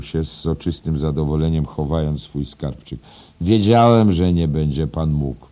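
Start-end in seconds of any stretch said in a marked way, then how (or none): 3.4-5.5 loud, high start that eases off; cheerful. A narrator's reading. Headmaster amused at teacher's debts, cheerful and raised. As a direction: crow it merrily. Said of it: "powiedział wesoło".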